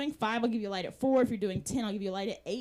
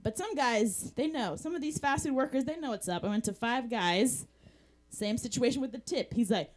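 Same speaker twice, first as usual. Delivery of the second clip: normal talking voice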